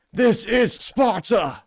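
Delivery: high, slow, loud movie war cry; hammed-up war cry; mock Spartan battle cry. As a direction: bellow it like a Spartan king. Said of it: "in her head she's just thinking".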